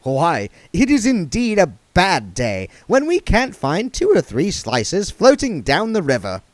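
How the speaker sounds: high, fussy genteel voice; prissy old vagrant, fussing; duck man voice. A narrator's reading.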